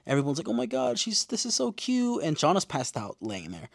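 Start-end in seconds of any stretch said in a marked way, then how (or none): 0.5-2.2 high-pitched mock gushing. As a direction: coo like the adoring onlookers. Retold gushing group reaction.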